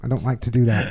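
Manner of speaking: playing his wife politely refusing in English accent. Imitating wife's English accent, turning down the podcast. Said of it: "I like when you do her English accent".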